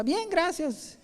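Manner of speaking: high, soft "bien, gracias"; bright automatic reply; chirpy polite fake fine.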